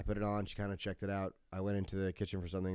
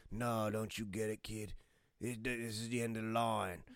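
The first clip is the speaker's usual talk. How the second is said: growing quieter, a resigned put-on accent; Jason Statham; 7 dB quieter, slower than usual. Crosshair telling the kid it's over, Jason Statham. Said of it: "he's Jason Statham, apparently".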